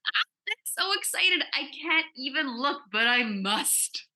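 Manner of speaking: high, slow thrilled squeal; bursting with excitement about the dress; giddy excitement. Acted.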